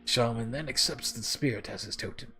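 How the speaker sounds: lightly voiced mentor, measured and uneven; wandering character voice. Algernon explains insect spirits to the orc, in a lightly voiced, wandering character voice. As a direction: explain like a learned character, the voice drifting slightly. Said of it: "My voice for him is just going all the way around".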